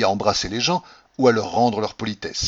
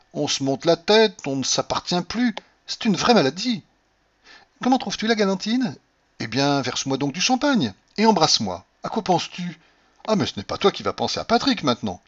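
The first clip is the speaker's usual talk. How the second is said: lightly voiced heroine in a higher register; wife prattling on to her husband, pitched up; Cordelia chattering; 7 semitones higher than usual. A narrator's reading. (babillage d'épouse, voix nettement plus haute)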